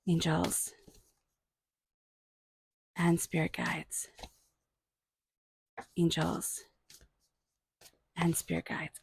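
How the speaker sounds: soft, slow prayer-like calling to the angels; quiet invocation. Acted. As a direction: murmur the plea to the spirit guides. Repeats invocation during reading.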